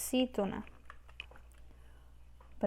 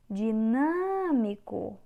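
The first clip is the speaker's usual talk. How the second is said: "Dinâmico" with a long, drawn-out NÂ; slow down and swell on NÂ in "Dinâmico"; stretched stressed syllable; much slower than usual. Shown as a demonstration. Teacher showing students the tonic syllable, stretched stress. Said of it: "A sílaba tônica é o nan".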